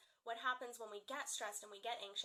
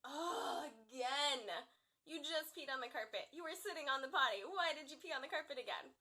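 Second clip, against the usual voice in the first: put-on louder, exasperated scolding of a toddler; frustrated; 4 semitones higher, 5 dB louder than usual. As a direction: let the frustration burst out at the child. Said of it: "I was frustrated and I was like".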